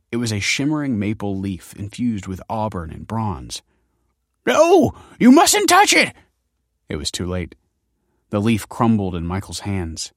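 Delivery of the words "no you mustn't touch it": very high, loud alarm; horrified scholarly screech; panicked cry. A narrator's reading. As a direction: shriek a frantic warning. Scholar panics over his cargo.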